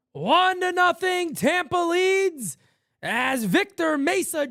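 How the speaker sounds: loud, high, stretched-out shouted call; home run call. Real, not acted. Play-by-play caller to viewers, a loud, high home run shout. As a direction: ride the ball over the wall with your voice.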